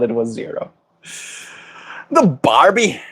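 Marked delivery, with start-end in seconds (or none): 2.1-3.0 high, swooping mock Aussie; Australian accent. Streamer poking fun at Australians, hammed-up Australian accent. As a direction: exaggerate the Australian vowels. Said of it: "I can't do an Australian accent".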